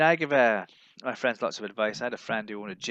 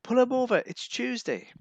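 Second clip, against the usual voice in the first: mocking police voice; 12 semitones higher than usual. Police spotting the Englishman, high jeering voice. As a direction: jeer at him like a greedy policeman. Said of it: "he said".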